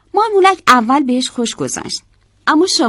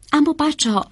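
ordinary delivery

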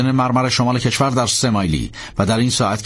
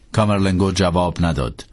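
ordinary delivery, nothing marked

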